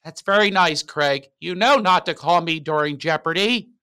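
imitated mom, high and loud; hammed-up mother cutting the call short; dismissive mother. Voices mother brushing him off.

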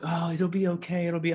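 mock reassurance. Retold comfort from other people.

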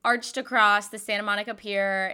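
ordinary talk

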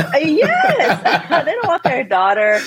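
laughing through the words; high, amused laughter while explaining. Real, not acted. Guest laughing while recalling her parents' fears.

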